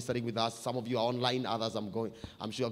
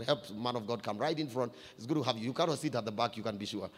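normal talking voice

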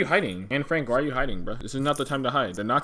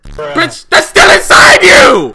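screaming high and very loud; drawn-out shocked yell; outraged shout; 21 dB louder, much slower than usual. Real, not acted.

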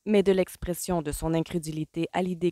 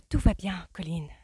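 hasty soothing; 3 semitones higher than usual. A narrator's reading. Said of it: "dit Pénélope en hâte".

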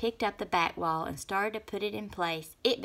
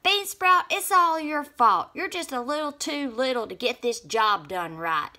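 angry blaming; 7 semitones higher, 8 dB louder than usual. A narrator's reading. Snap Bean blames Bean Sprout.

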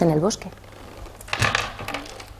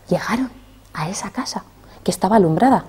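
suspense whisper; 8 dB quieter than usual. A narrator's reading. Suspense before the ogre's house.

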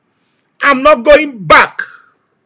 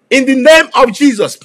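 normal talking voice

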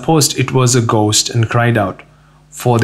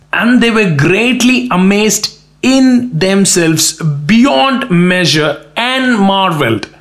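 high, slow, drawn-out marvelling; impassioned preaching, pitched high; amazed emphasis; 7 semitones higher, slower than usual. A narrator's reading. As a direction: stretch every phrase in wonder. Preacher dramatizes the disciples' amazement.

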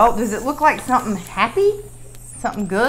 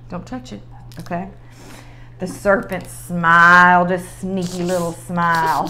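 ordinary talk

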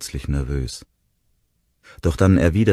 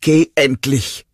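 slow, much higher, loud scream; 11 semitones higher, 8 dB louder, slower than usual. A narrator's reading. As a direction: scream at him to go. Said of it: "schrie sie".

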